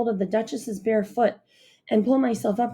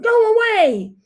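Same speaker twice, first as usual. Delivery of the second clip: much higher, loud roar; bear roaring; 13 semitones higher, 8 dB louder, slower than usual. A narrator's reading. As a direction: roar him off the tree.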